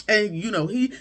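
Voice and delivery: ordinary delivery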